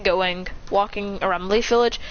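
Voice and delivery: ordinary delivery, nothing marked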